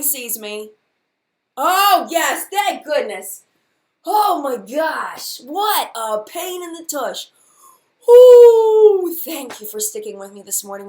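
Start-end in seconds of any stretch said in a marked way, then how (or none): 1.6-3.4 loud, slow burst of relief; big loud relief, slowly; relieved exclamation. Real, not acted. Relief once stream works. 4.1-7.3 exasperated. Real, not acted. Exasperated at stream trouble. 8.1-9.1 a loud, much higher ooh squeal; high relieved squeal. Real, not acted.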